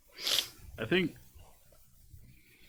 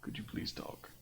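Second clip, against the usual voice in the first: very soft whisper; barely audible, coaxing whisper; hushed plea; 16 dB quieter than usual. Acted. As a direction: whisper the request. Player coaxes the monkey to talk.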